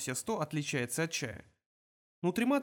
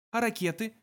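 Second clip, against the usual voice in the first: ordinary delivery, nothing marked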